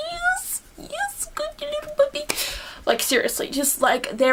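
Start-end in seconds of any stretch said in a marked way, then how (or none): 0.1-2.2 tiny, very high soft squeal; squeaky praise for a little puppy; gushing coo. Retold or imitated. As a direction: coo it very high, dropping to a near whisper. Her cooing reaction to the baby.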